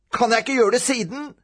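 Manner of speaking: put-on high, wheedling child's question; pleading boy. A narrator's reading.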